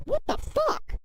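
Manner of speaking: high squeaky outraged yelp; shocked. Retold or imitated. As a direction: squeak it out in disbelief. Retold being punched, reacting.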